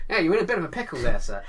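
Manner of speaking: P=A; fast chatty stranger. Retold or imitated. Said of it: "you just lean in and go".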